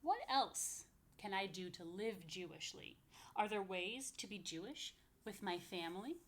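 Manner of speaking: lively picture-book reading; reading to children. Acted. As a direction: bounce the rhymes for little listeners. Reads rhyming picture book aloud.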